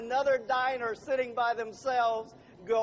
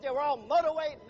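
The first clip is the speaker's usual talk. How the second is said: ordinary talk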